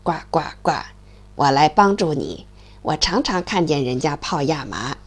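low croaky frog. Acted. The frog offers help in a low frog voice. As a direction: speak low like a friendly frog.

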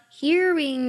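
"hearing" run together as here-in; linked words. Shown as a demonstration. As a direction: link "hearing" smoothly, no break between here and in. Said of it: "有非常自然的连读". (把“hearing”自然连读)

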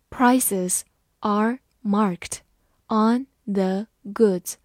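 very slow, word by word; slow reading. Shown as a demonstration. Teacher reading slowly for the class. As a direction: read it slowly so students follow. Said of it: "慢慢来读".

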